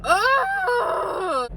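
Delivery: high shouted ugh of cold; frustrated cry. Real, not acted. Cold outside.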